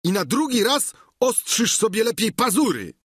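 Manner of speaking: high, indignant retort; exasperated high-voiced complaint; Sancho calling out. A narrator's reading. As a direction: throw it after her, pitched well up. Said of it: "zawołał Sancho".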